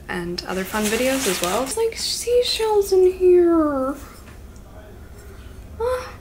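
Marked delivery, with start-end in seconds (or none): 1.6-6.1 delighted. Real, not acted. Discovering gift contents.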